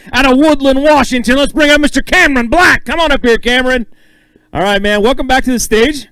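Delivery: loud, stretched-out announcer intro; big stage emcee calling the next angler up. Acted. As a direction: boom the name out like a ring announcer.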